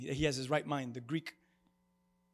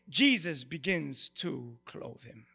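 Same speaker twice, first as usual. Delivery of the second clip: ordinary talk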